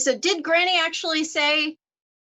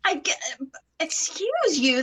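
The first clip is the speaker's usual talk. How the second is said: ordinary talk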